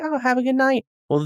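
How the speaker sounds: ordinary talk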